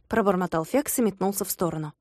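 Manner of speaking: ordinary delivery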